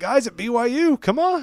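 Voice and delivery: high, pleading appeal; exasperated plea. Retold or imitated. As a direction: urge them on, half joking. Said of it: "You're like".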